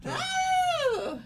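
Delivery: sudden high, loud shriek of "Oh!"; startled high scream. Acted. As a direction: let out a piercing shriek. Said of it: "That was rather intense scream".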